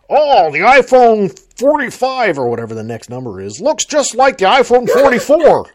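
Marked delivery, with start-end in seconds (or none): 0.1-2.4 high, loud, slow sarcastic mimicry; mocking quote. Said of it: "any article saying". 3.6-5.7 high, loud sarcastic mimicry; mocking quote. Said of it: "any article saying".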